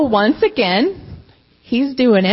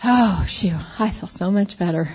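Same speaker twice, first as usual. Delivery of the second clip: ordinary delivery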